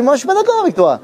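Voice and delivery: high-pitched mock objection; voicing a disagreeing sage. Rabbi voices the Shulchan Aruch objecting to the Rambam, high mock protest. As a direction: lift the voice way up to play the sage who objects. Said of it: "qui lui dit".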